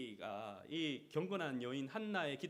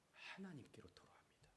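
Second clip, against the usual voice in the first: hushed, breathy whisper to close; 15 dB quieter than usual. Real, not acted. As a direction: drop to a secret whisper. Sudden whisper in sermon.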